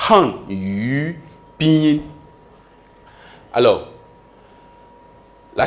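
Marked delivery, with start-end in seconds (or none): 0.1-2.0 "Han Yu Pinyin" slow, syllable by syllable; modelled pronunciation. Shown as a demonstration. Teacher asks class to repeat after him, slow modelled pronunciation. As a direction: draw out "Han Yu Pinyin" for learners to copy. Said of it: "Répétez après moi".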